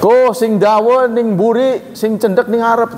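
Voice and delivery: lightly voiced teacher in Javanese; teacher's instruction. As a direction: imitate the old teacher arranging a photo. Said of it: "saya pernah dapat omongan begitu dari guru saya".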